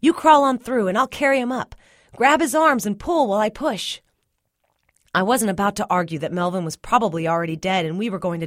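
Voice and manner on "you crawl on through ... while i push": high, forceful instructions; urgent orders. A narrator's reading. Cyril directing the rescue in smoke, urgent.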